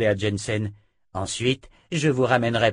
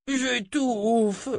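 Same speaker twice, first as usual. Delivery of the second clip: high, strained choking wail; pleading moan, pitched way up; 13 semitones higher than usual. A narrator's reading. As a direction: groan it out like someone suffocating. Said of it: "gémit Oba".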